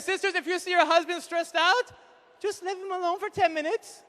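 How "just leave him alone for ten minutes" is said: normal talking voice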